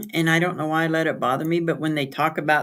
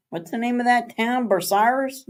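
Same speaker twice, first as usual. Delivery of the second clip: mock puzzled outsider; 7 semitones higher than usual. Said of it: "people try to say what it is".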